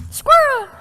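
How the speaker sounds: high, loud sudden exclamation; mock squirrel yell. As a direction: blurt it out comically. Joking about being distracted.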